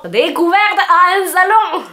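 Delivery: mock German accent, slow and drawn. Shown as a demonstration. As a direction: give "Découverte à un salon" a hammed-up German accent. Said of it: "je me retiens de pas faire l'accent allemand".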